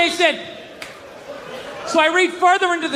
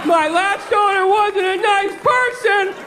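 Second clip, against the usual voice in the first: pitiful dog voice; 6 semitones higher, slower than usual. Retold or imitated. Voicing sad shelter dog.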